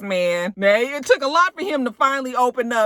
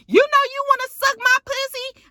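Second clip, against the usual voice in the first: very high, brazen mock taunt; hammed-up high-pitched challenge; mimicked taunt; 4 dB louder than usual. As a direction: imitate a diva goading her rival in a high voice. Mimics Prima Donna's taunt.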